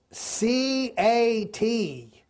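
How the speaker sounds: drawn-out letters of a struggling beginner; laboured spelling. Acted. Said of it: "it was so tough".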